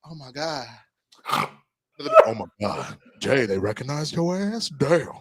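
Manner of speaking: slow, hushed swooning imitation; impressed partner. Acts out impressed partner's reaction.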